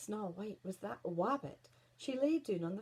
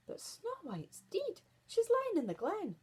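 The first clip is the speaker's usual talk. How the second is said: shocked queen; 11 semitones higher than usual. Acted. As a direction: insist in disbelief. Queen protests.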